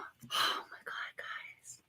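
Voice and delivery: slow, hushed whisper of amazement; breath-only, real astonishment; awed whisper. Real, not acted. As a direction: whisper it to your viewers in wonder.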